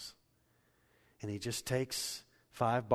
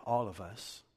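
normal talking voice

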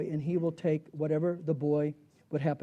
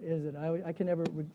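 ordinary talk

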